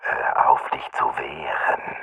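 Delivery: breathy, gentle whispered warning; soft whisper. A narrator's reading. Attacker whispers softly to the pinned victim, a soft whisper. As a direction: whisper it softly, close to the ear. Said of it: "Jemand flüsterte mir sanft".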